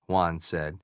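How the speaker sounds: ordinary delivery, nothing marked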